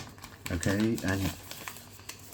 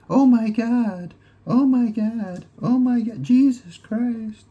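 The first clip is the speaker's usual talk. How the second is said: much higher, loud, ecstatic mock screaming; mock sex screams; 8 dB louder, slower than usual. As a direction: scream it out like a porn scene, hammed up. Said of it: "women screaming".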